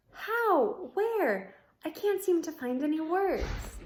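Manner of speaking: high, breathless astonishment; amazed. Acted. Ivan speechless seeing Julia and Bob, high and excited. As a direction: stammer out the questions in wonder.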